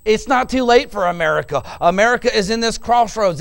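ordinary talk, nothing marked

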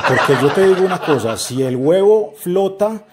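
ordinary delivery

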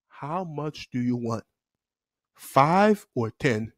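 slow, soft, menacing question; stern teacher. Retold or imitated. Teacher asks a lined-up pupil to pick suspension days, stern. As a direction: drop low and draw each word out. Teacher quietly sentencing each boy.

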